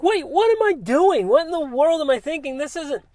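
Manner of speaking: acting out a sudden wake-up call to himself; incredulous self-questioning. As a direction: catch yourself and protest in a high voice. Acts out his sudden self-questioning.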